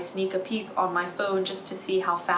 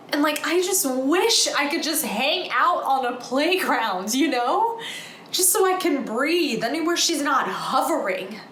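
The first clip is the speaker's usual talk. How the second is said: higher-pitched, pleading exasperation; exasperated venting; 6 semitones higher than usual. Acted. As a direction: vent like you badly need some breathing room. Daughter vents about hovering mother.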